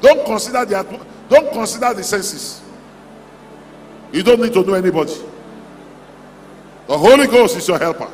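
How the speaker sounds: ordinary talk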